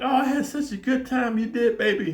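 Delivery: high girly voice, put on; girlfriend's voice. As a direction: mimic the girlfriend gushing sweetly. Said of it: "And she's like".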